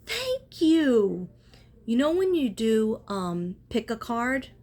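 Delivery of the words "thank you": high, loud burst of thanks; excited thanks. Retold or imitated. Thanking God for a good idea, high and loud. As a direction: sing out a delighted thank you. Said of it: "I was like".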